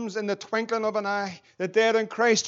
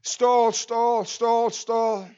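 flat repeated robotic alarm; computerized voice; 4 dB louder, much slower than usual. Retold or imitated. Preacher repeating the cockpit alarm, mechanical. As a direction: chant it like a warning system. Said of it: "this computerized voice".

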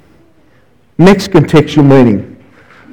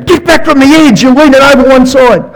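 high, urgent warning call; alarmed disciple; 8 semitones higher than usual. Retold or imitated. A disciple warning Peter off the edge, high and urgent. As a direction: pull him back from the edge, pitch way up. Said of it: "I could just imagine one of them saying".

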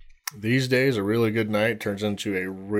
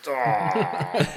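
hungover groan; 9 semitones higher than usual. Retold or imitated. Wife waking up hungover.

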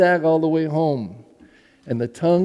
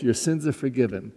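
tender; 4 semitones lower, 7 dB quieter than usual. Retold or imitated. Repeating Jesus' words.

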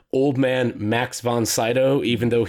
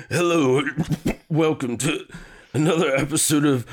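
put-on frail elderly man clearing his throat; very old man; 3 semitones higher than usual. Shown as a demonstration. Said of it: "What's your old man impression".

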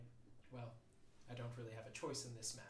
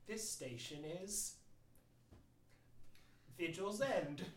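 slow, higher, even announcer voice; automated train announcement; 7 semitones higher, 8 dB louder, slower than usual. Pretend. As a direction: announce each stop slowly. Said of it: "an automated voice that goes".

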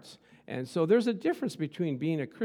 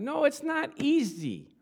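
booming motivational speech; 4 semitones higher than usual. Acted. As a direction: preach it, drawing out each phrase. Preaching at full force.

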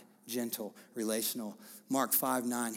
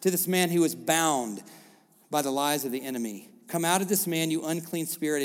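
loud, higher commanding preaching; full-force preaching; 6 semitones higher, 8 dB louder than usual. Acted. Pastor proclaiming Jesus's command, loud preaching. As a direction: declare it over the room.

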